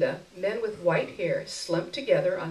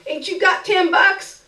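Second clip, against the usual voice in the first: much higher, louder demand; haggling beggar woman; 12 semitones higher, 10 dB louder than usual. A narrator's reading. Beggar woman to narrator, high cheeky demand for more. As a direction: push her luck, cheeky. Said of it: "she said as I picked".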